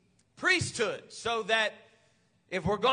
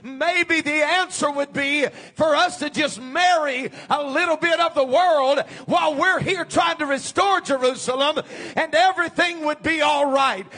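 loud, higher preacher's call, driving hard; full-force preaching; 5 semitones higher, 7 dB louder than usual. Real, not acted. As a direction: thunder it out to the congregation.

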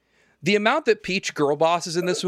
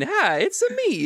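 squeaky, much higher hello; mock Mario greeting. Mocking the in-universe commercial with a squeaky high hello. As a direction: chirp it like a cartoon mascot. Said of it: "they show him going".

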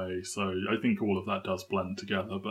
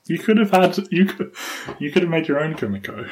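excited teasing; 8 semitones higher, 8 dB louder than usual. Real, not acted.

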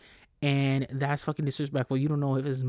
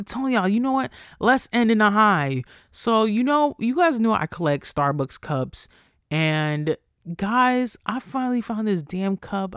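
higher and louder, bursting with excitement; excited news; 6 semitones higher, 4 dB louder than usual. Real, not acted. Host excitedly tells listeners she found the cup. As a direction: gush about the cup find.

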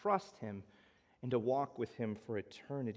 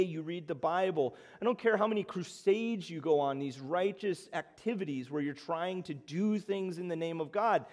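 higher and louder, driving each point home; full-force preaching; 6 semitones higher, 4 dB louder than usual. Acted. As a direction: preach it hard, pressing the crowd.

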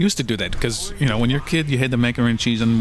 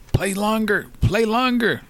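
urging crew; 11 semitones higher, much slower than usual. Retold or imitated. Stage crew urging the band to keep playing. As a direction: hurry the band on, pitched high. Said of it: "they would say".

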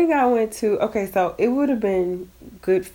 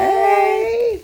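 excited; 13 semitones higher, 4 dB louder than usual. Acted. Greeting listeners.

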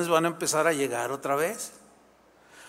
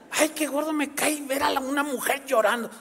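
higher, mocking husband voice; a pitched-up dismissive husband; scornful husband; 7 semitones higher than usual. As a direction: sneer at the crying as the husband. Husband scoffs at crying wife.

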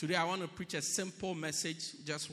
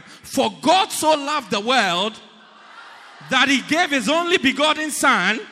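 shouted, much higher and louder, rushing; quickest possible recitation; 10 semitones higher, 20 dB louder than usual. Shown as a demonstration. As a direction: race through it at full shout. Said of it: "say it in the quickest possible time".

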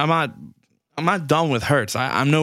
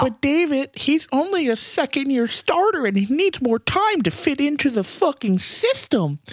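high, whiny put-on voice of a naive fan; mock apologist voice; 14 semitones higher than usual. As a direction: parody the excuse-maker, pitched way up. Mocking a patient fan's excuse.

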